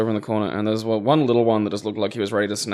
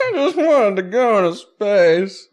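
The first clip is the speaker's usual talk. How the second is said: high, loud, slow mock lament; mock wail; 10 semitones higher, 5 dB louder, slower than usual. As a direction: wail it like a hammed-up pathetic excuse. Mocking the villain's feeble excuse.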